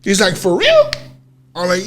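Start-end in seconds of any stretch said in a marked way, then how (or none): 0.4-0.9 high-pitched incredulous question; imitating a friend's surprise, pitched way up; retold disbelief. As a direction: squeak it out in mock disbelief. Retold disbelieving reaction to news.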